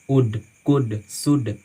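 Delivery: वुड, कुड, शुड each with a silent L; short vowel straight into D in वुड, कुड, शुड; correct pronunciation. Shown as a demonstration. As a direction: model वुड, कुड, शुड without any L. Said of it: "इसको हम पढ़ते हैं".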